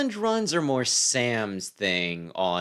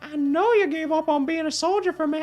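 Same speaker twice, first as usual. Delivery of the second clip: put-on much higher young hero voice; hot voice. Son telling his father he'll fight, high voice. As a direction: play the eager son in falsetto. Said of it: "give him the hot voice".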